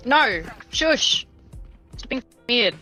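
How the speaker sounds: ordinary delivery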